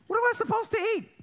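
high, loud mock-indignant child; protesting kid. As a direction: whine back at mom in comic disbelief.

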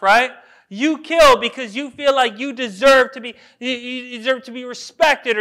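loud, punchy sermon delivery; full-force preaching. Acted. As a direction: drive each point home to the congregation.

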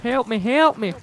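high, breathy damsel calling for help; fluttering cries for help. Pretend. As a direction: cry out like a damsel in distress. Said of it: "You sound like you're the damsel in distress".

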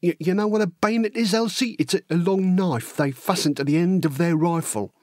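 lively, wide-eyed working-class lad spinning war tales; excitable storytelling with big pitch leaps; eager young Cockney recruit. Acted. Horace telling Elsie gruesome war stories, eager and excitable.

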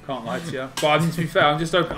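usual speaking voice

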